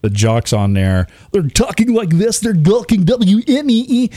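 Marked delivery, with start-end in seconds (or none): 1.3-4.1 much higher, hammed-up corporate DJ voice; over-the-top radio announcer imitation; mock radio jock. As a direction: parody a cheesy station jock. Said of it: "that's exactly how he says stuff".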